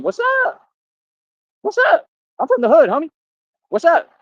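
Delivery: high-pitched, taunting call-out; mock tough challenge. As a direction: throw it out as a high, cocky challenge. Acted tough challenge to online critics.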